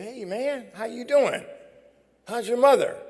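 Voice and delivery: friendly greeting at the door, high and loud; cheery greeting. Retold or imitated. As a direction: greet your friend with a big lift.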